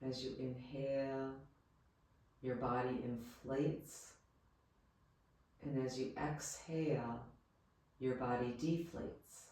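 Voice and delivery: soft, calm Yoga Nidra guidance with long pauses; guided meditation. Real, not acted. Yoga teacher leading listeners into rest, guided meditation voice. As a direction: guide them gently, leaving space after each phrase.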